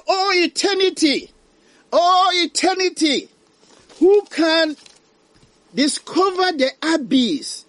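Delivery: high, loud wail of words; preacher's anguished outcry; dramatic lament. Retold or imitated. Reads damned man's lament aloud.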